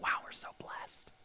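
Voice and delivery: hushed mock whisper; whispered smug gratitude. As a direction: breathe it like a cozy secret. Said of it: "we're gonna be like".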